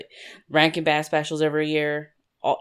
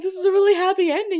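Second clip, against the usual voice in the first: much higher and fast, teasing; mock high voice; 12 semitones higher, faster than usual. Teasing her friend for crying at a happy film, mock high. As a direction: squeak it like a baffled friend. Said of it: "I'm like".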